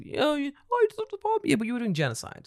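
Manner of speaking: high, sarcastic mock voice; mocking high retort. Mocking Hiroshima's complaint in a high sarcastic voice. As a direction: squeak it out like a dismissive parody.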